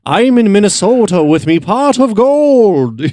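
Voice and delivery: high, overdone leprechaun Irish voice; cartoon Irish accent, hammed up. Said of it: "how not to do it".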